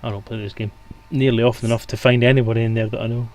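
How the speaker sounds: usual speaking voice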